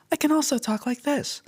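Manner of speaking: squeaky, very high pitch. Shown as a demonstration. Demoing intra-speaker variability, voice pitched very high. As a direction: jump to the top of the range. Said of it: "the manipulations I just did with my voice".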